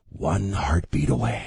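low, slow ominous warning; slow, deep teaser of looming dread; mock ominous. Ominous warning, played for effect.

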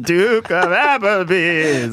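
slow, drawn-out goat voice. Acted. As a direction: bleat the name like a goat. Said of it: "Do your best goat".

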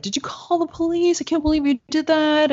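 high, disbelieving mock indignation; imitating an indignant husband, voice jumped high; incredulous outrage. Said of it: "And he's like".

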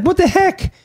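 high-pitched disbelief; outraged. Retold or imitated. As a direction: squeak out shocked outrage. Retelling outrage.

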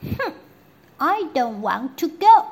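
put-on loud, high childish protest; princess refusing. Princess refusing the doctor, loud and high. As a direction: protest like a sulky little girl. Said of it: "said the princess".

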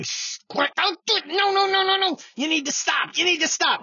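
high, urgent pleading that starts as a hushed hiss; panicked senators begging him to stop; frantic high pleading. Retold or imitated. Moderate senators begging Schumer to stop, high and frantic.

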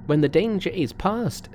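high, emphatic Monkey voice; instructing Monkey. Acted. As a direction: give magic instructions in a high, sure voice.

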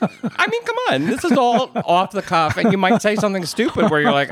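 high-pitched, laughing protest; amused and pitched well up. Real, not acted. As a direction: talk through real laughter. Laughing over possible on-air blunders.

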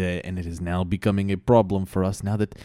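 ordinary delivery

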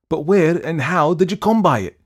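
high, loud startled demand; crying out. A narrator's reading. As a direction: cry out the question in alarm. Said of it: "cried the other".

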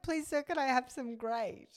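put-on high pleading child's voice; mock orphan plea. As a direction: beg like a workhouse boy, mockingly. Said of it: "it's not like".

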